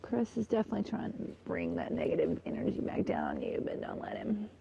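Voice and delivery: low warning whisper. Real, not acted. Warning herself about Chris, in a whisper.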